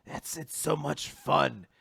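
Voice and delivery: high, singsong villainous relish; gloating villain, imitated. Villain boasting of controlling people, mock-gleeful. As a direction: revel in it, a hammed-up high villain voice. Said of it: "like, laughing to himself".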